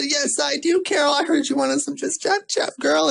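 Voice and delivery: high, sassy "yes I do"; sassy reply. Retold or imitated. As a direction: answer high and playful. Herself voiced sassy.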